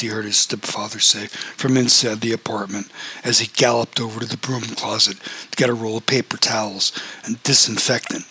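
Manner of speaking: usual speaking voice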